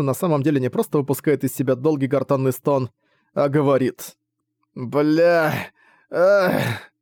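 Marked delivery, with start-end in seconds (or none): 4.7-6.9 dying groan. Acted. Gutted man groans out words.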